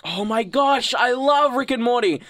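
high, loud burst of fan delight; excited gushing. Real, not acted. Gushes about a favourite show.

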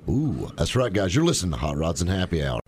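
normal talking voice

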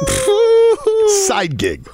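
skeptical dad. Pretend. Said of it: "I'd be like".

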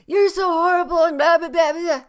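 high, loud mock tirade; hammed-up angry outburst; ranting accuser. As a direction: rant shrilly into blah-blah.

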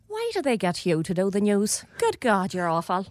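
nana's Irish brogue; Irish accent. Retold or imitated. Nana to new newsreader granddaughter, blunt Irish accent. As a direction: quote an Irish nana in her own accent. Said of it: "Mel, Mel, you do Irish".

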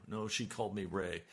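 usual speaking voice

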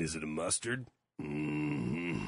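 low put-on voice, deep and odd; weird voice. Co-host asking about pizza sauce in a weird low voice. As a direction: drop into a strange low voice. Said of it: "that is a weird voice to say it in".

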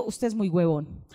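voz más grave y pausada de policía; policía citado. Retold or imitated. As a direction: imitar al policía, bajo y lento. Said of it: "el policía le dijo". (policía grave y pausado, imitado)

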